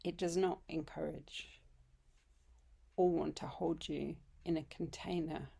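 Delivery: unhurried meditation voice with long pauses; guided meditation. Acted. Yoga teacher welcoming listeners home to yoga nidra, guided meditation. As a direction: lead them gently into rest, phrase by phrase.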